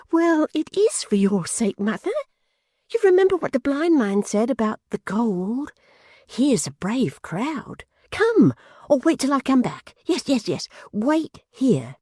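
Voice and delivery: put-on high, excited young man's voice; eager simple youth. A narrator's reading. Barnaby urging his mother to let him go, high and eager. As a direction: bubble over like an eager boy.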